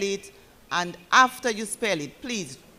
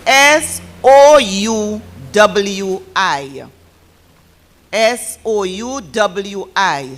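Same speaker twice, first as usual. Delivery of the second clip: loud, slow, letter by letter; slow spelling out; 10 dB louder, much slower than usual. Real, not acted. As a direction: spell it out slowly and loudly for the class. Spells out correct answer after mistake.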